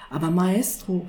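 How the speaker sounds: ordinary delivery, nothing marked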